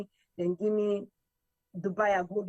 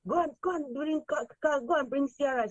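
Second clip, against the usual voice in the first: pitched up high, urgent mock call to fetch Sierra; excited retelling; 7 semitones higher than usual. Retelling the pageant mother sending someone to fetch Sierra, high and urgent. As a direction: hurry them off, voice lifted well above normal. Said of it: "he said".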